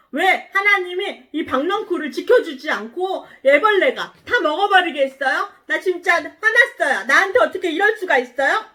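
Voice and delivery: high, loud tirade speeding up; acted heated complaint; angry accusation. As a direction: demand an answer in indignation. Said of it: "화를 낸 거예요".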